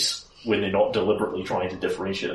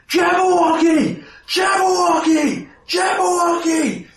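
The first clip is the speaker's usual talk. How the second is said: high, full-voiced alarm shout; guard screaming out a warning; yelling guard; 8 dB louder than usual. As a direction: yell it over a panicking crowd, each cry held high. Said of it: "one guard yelling louder than all the rest".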